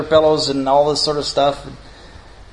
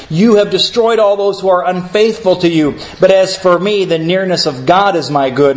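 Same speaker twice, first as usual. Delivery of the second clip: scripture reading; 5 semitones higher, 3 dB louder than usual. Acted. Pastor reading the psalmist's words to the congregation, solemn and raised.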